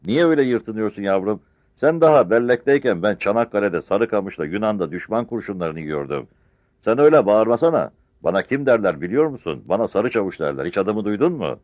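put-on old soldier putting a young officer down; defiant old veteran. Old soldier scolds the captain. (subaya çıkışan yaşlı çavuş)

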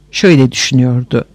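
plain reading voice

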